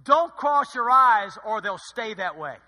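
slow, loud motherly warning; mock mom warning. Preacher mimicking a mother's warning, slow and loud. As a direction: drag it out like a nagging mother.

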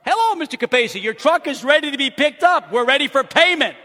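brisk mock customer-service call; dealership caller. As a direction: announce it like a business call. Voicing dealership caller on phone.

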